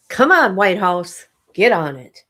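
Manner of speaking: loud, impatient calling out; loud urging. Real, not acted. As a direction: push the computer along out loud. Urging the computer to load.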